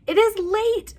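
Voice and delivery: high squeaky mouse voice; squealing mouse. Acted. Worried Mouse telling friends it is late, high squeak. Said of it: "Mouse squeals".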